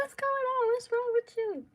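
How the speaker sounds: very high, loud worried questioning; imitated alarmed sister. Sister seeing her run from the apartment, imitated alarm. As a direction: mimic a sister calling out in alarm.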